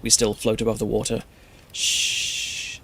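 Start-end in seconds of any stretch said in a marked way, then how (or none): 1.7-2.8 long, voiceless shush; comforting "shh"; soothing shush. A narrator's reading. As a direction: hush someone gently.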